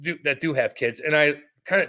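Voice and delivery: ordinary talk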